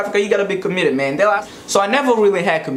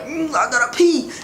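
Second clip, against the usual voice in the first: higher, squirming little plea; whiny urgent need; 8 semitones higher than usual. Retold or imitated. Boy at the doctor's, higher urgent whine. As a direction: whine like you're about to burst. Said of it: "I'm sitting there like".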